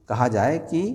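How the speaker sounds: ordinary talk, nothing marked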